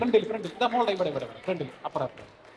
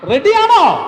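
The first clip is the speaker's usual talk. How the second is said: high, loud rallying cry; rousing shout; 7 semitones higher, 7 dB louder, much slower than usual. Real, not acted. Leader rouses children before the first game, rousing shout. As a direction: fire up the crowd with a shout. Repeats rallying call, louder response wanted.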